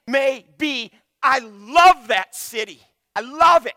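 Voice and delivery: loud, high, slowed declaration of love; impassioned plea. Acted. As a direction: pour out love for the city. God voiced pleading for the city.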